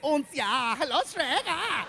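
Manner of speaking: lightly high, mock German piglet voice; German pig accent. Comedian imitating the Shrek pigs, mock German accent. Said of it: "all three little pigs".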